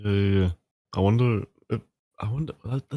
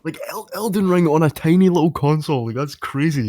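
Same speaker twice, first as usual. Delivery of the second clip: high-pitched excited marvelling; real amazement; 7 semitones higher than usual. Real, not acted. Marvelling to a friend about Elden Ring on a tiny console, amazed. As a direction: say it amazed, voice jumping up.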